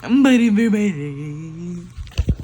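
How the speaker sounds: slow, loud drawn-out wail; dramatic wail. Real, not acted. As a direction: moan it out like you're dying.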